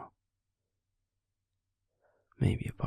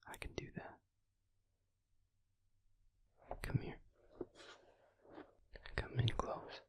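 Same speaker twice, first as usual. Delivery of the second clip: soothing whisper; 6 semitones higher, 7 dB quieter than usual. Acted. Comforting the listener to rest.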